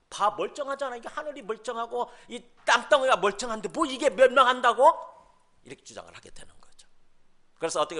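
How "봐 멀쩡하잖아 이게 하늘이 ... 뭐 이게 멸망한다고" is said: louder, higher scoffer's protest; acted-out indignant disbelief; incredulous scoffing. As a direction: protest loudly in mock disbelief. Acting out scoffers denying the end.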